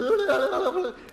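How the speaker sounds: high wordless gibbering; comic noise of total panic; panicked babble. Acted. Husband panicking over his wife in labour, gibbering noise. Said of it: "I'm panicking".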